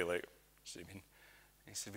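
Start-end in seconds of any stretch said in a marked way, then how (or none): 0.2-1.0 barely voiced, puzzled murmur; quizzical. Young John to the old minister, quizzical near-whisper. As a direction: ask it under your breath, quizzically. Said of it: "looked at him quizzically".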